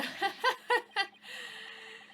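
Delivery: high, giddy cackle of delight; a silly high-pitched cackle; a squeaky gleeful cackle. Acted. Character laughs after flattery.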